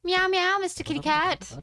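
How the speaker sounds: high, sing-song cooing at a pet. Real, not acted. Streamer cooing to his pet cat in high baby talk.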